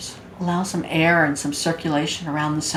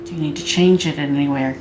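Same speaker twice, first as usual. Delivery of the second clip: guided meditation. Acted. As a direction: guide them gently into body awareness, unhurried.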